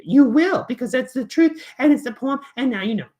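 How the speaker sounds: high, bossy puppet voice; Peter. Acted. Puppet coaches kindergarten against other voices, high and insistent. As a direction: lecture in a high, excitable character voice. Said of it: "and then Peter says".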